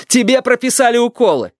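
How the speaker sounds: high-pitched exasperated shout; acted grandson's furious cry; angry yell. A narrator's reading. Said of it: "заорал Юрик".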